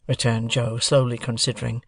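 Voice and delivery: ordinary delivery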